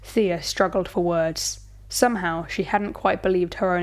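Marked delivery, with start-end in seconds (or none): none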